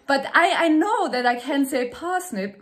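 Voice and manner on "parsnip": parsnip with a British accent. Shown as a demonstration. Showing she can say parsnip, British accent. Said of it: "very British".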